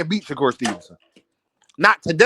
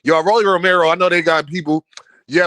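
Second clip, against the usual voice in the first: ordinary talk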